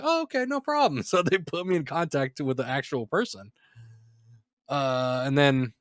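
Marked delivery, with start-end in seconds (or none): 0.0-1.0 high, chirpy mock voice of a phone bot. Phone bot agreeing to help, in a high chirpy mock voice. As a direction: play the cheerful robot who gives in. Mimicking the virtual assistant's reply.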